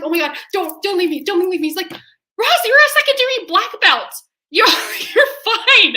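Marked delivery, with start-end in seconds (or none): 0.0-1.7 high, loud retold panic; hammed-up begging not to be abandoned; mock panicked plea. Wife calls to husband running from haunted house, mock panicked. Pleading with fleeing husband in retelling. 1.9-6.0 high, loud mock-exasperated shouting; exasperated husband. Husband to frightened wife in haunted house, hammed-up exasperation. Husband scolding her, retold with laughter.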